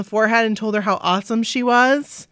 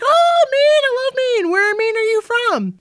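much higher, eager gush about Maine; bubbly star squealing his love of Maine; gushing celebrity; 14 semitones higher, 4 dB louder than usual. Retold or imitated. The singer asking the fan about Maine, high and eager. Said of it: "He's like".

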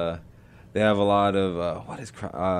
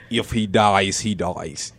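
Rocky IV quote; 3 semitones lower, 4 dB quieter, slower than usual. Retold or imitated. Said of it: "that's a Rocky four reference".

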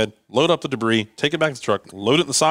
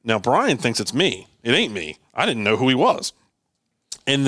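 normal talking voice

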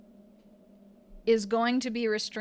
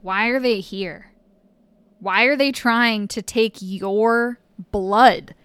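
slow, loud, menacing inner voice; 8 dB louder, slower than usual. Acted. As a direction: tempt them toward rage, slowly. GM voicing rage-filled inner thoughts.

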